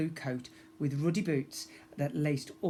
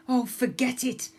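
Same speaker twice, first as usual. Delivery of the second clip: higher, louder exasperated shout; 9 semitones higher, 5 dB louder than usual. A narrator's reading. Pirate girl giving up on her mate, loud exasperated shout. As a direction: give up in a huff, loud. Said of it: "the girl's voice shouted".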